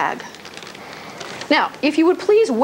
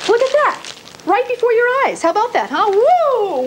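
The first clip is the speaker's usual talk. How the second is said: high, thrilled magic reveal; excited reveal; 8 semitones higher than usual. Acted. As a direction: show them the trick with delight. Trick reveal to kids.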